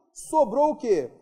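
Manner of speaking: ordinary talk, nothing marked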